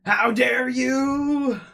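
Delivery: high, slow, loud mock fury; over-the-top mock angry shout; hammed-up dub rage. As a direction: drag out the outrage like a bad dub. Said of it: "he's mad about his sister".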